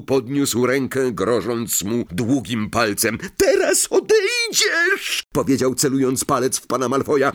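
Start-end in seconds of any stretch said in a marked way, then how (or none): 3.4-5.2 very high, slow squeaky elf giving an order; elf command. Acted. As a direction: point and order him out. Elf commands Malfoy to leave.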